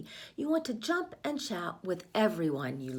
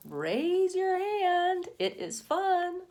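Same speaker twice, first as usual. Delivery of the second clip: excited call; 12 semitones higher, 6 dB louder, much slower than usual. Acted. Teacher rallying listening children.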